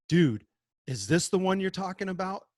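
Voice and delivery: lightly acted excited question; eager kid. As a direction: pitch it up like an eager learner reporting back. Acts out excited kids returning.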